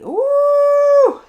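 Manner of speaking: letting out pent-up energy with a loud high ooh; high loud release cry. Real, not acted. Vlogger expelling energy alone, loud high ooh. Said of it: "Sorry for the noises".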